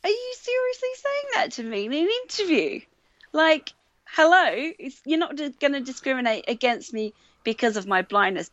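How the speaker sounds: high-pitched, disbelieving mock protest; incredulous indignation. Job candidate silently challenging the interviewer over discrimination, incredulous. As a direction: retell your shocked inner reply, pitch shooting up.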